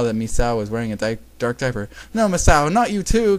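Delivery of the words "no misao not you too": high, loud shocked plea; raised voice, taken aback; shocked protest. A narrator's reading. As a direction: cry out in disbelief. Kagami backs away in shock.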